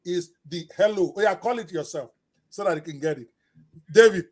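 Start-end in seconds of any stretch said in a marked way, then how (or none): 3.9-4.3 calling out. Real, not acted. Host urging guest to say it.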